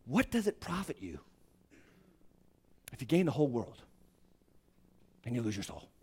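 low, soft, sinking to near silence; a hint of solemn quiet; quiet Jesus quote. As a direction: let the question fade away. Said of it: "He said it like this".

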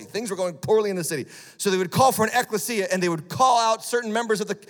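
loud, high-pitched fervent preaching; preaching at full force. Acted. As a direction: preach it to the congregation with fire.

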